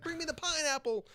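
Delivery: very high, put-on mock plea; mock demand. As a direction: squeak it out as a joke demand. Mock safe-word demand nobody says.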